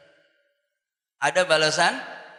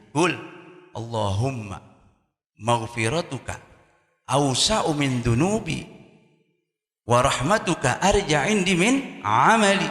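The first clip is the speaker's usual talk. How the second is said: slow prayer recitation; 3 semitones lower, 3 dB louder, slower than usual. Acted. Recites prayer taught by Prophet.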